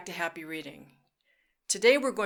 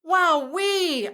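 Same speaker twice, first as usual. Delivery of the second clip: high, loud wowee; thrilled like flying upside down; excited wowee; 11 semitones higher, 8 dB louder than usual. Shown as a demonstration. Teacher cheering like a plane, excited. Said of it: "your turn to make the sound".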